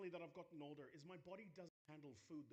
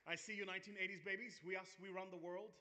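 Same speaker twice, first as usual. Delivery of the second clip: loud call to the crowd, higher; 4 semitones higher, 8 dB louder than usual. Real, not acted. Speaker hails the eighties babies in the crowd, loud call. Calling out to the audience.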